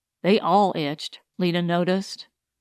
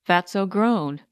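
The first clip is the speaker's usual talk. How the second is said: ordinary delivery, nothing marked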